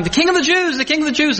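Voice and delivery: high-pitched chant, no real volume; mock crowd shouting. People shouting as Jesus enters, high imitated cheer. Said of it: "People are shouting".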